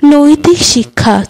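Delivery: ordinary delivery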